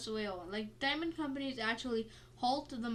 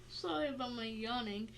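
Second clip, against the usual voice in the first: normal talking voice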